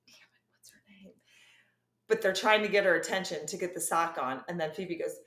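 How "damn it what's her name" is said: whisper. Retold or imitated. Friends forgetting mom's name, whispered.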